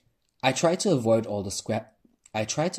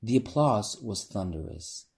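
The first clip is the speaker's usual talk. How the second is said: plain reading voice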